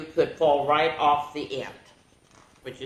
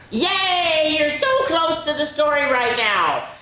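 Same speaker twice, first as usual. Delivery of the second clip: much higher, loud, squealing with excitement; excited bird; 13 semitones higher, 5 dB louder than usual. Acted. The bird urging young listeners to turn the page, excited. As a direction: cheer like a thrilled little bird. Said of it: "She's very excited".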